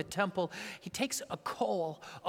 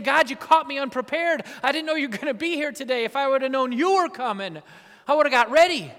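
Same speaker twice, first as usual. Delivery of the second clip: louder, higher caught-out pleading; panicked prophet; 7 semitones higher, 11 dB louder than usual. Acted. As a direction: plead like a man caught unready. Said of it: "as if Isaiah is saying".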